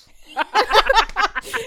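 high, loud laughing yelp; loud slapstick yelps. Real, not acted. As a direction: burst into a yelping laugh. Friends cracking up over the story.

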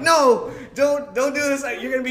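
high, loud mock-panicked pleading; frantic protest. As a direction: refuse as if terrified of betrayal. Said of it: "they're trying to calm me down".

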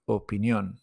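Opinión with the stress on «ón». Shown as a demonstration. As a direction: lean on the last syllable of «Opinión». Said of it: "It's always on the top of the vowel".

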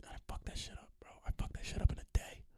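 hushed, intense whisper; very soft, voiceless admission; confessional whisper. Real, not acted. Confessing a binge, dreamily.

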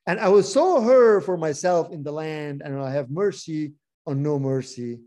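measured, earnest Bible reading; scripture reading. Acted. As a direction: read the verse like a preacher to a class. Reads Hosea aloud.

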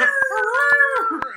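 high, loud howling roar; wolf roar. Retold or imitated. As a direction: let out a beastly roar. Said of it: "he's like".

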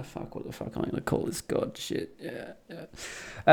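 muttered under the breath; whispered aside. Acted. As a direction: whisper to yourself, panicking. Grifter's aside to himself.

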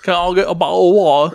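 put-on jokey British accent. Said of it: "he's fucking British".

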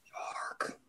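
hushed echo, all breath; whisper. Pretend. Host echoing her friend's shark aside, whispered. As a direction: whisper the word back very softly.

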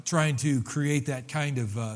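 ordinary talk, nothing marked

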